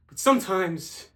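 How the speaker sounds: ordinary talk, nothing marked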